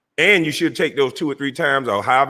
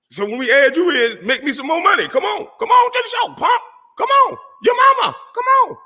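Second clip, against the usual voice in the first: shrieking taunt. Acted. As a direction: scream it at him, mocking and wild. Escalating taunt at rival.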